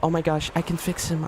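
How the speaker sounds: mocking female fans, gushing and high; mock fangirl. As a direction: squeal like a smitten fan. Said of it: "It's like".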